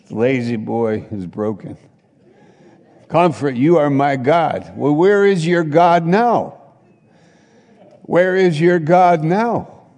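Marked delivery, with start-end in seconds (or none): 3.1-9.9 loud, high, mocking cry; preacher's booming taunt; shouting challenge. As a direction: throw the question at them hard. Preacher mocks the broken dream.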